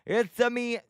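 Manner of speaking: playful sing-song mock-Italian voice; Mario impression. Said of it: "The only one I can do".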